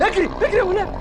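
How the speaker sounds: panicked yell. Acted. Panicked cry to flee.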